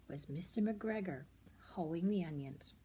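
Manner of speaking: ordinary delivery, nothing marked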